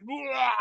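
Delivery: loud imitated retch; vomiting. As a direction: fake a dog puking. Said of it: "It just makes a puke sound".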